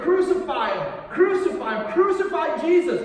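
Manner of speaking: high, loud crowd-style chant for blood; shouting out. Retold or imitated. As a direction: yell it like one of the mob. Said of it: "he's like shouting out".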